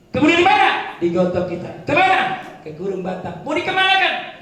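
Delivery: tanya retoris tinggi dan keras; rhetorical question. Acted. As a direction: lempar pertanyaan ke jemaah. (tanya retoris lantang)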